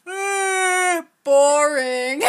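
loud mocking boo. Real, not acted. Mocking co-host's podcast.

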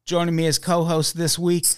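usual speaking voice

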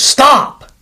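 sudden high-pitched shout; retold yell. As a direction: mimic the trainer's yell to halt. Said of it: "And he yells".

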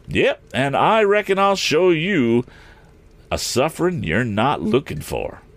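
high, feisty wagon driver; sudden cry. A narrator's reading. Eradicate answers Morse's threat, sudden spirited cry. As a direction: burst in with pitch way up. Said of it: "suddenly cried Eradicate".

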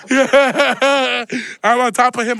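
drawn out through a laugh; real laughter stretching the words. Real, not acted. Laughs while telling the fight.

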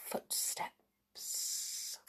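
breathy suspenseful whisper. A narrator's reading. Suspense before footsteps.

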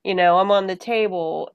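normal talking voice

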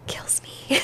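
soft broken whisper; barely voiced, hurting. Real, not acted. Whispered emotional aside.

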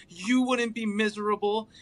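mild emphasis on "You"; stress on you. Retold or imitated. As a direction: lean on "You" in "You wouldn't be miserable". Listing who'd be happy.

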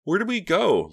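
put-on high, loud, eager little girl; excited interruption. A narrator's reading. Lisa cutting Reva off, excited and high. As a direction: cut in, bursting with excitement. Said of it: "Lisa interrupted excitedly".

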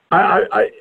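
halting, searching stammer; stuck on the first word, groping for the question. Real, not acted. Interviewer working out exactly how to ask a question, stammering. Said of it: "I'm stammering because I'm trying to figure out".

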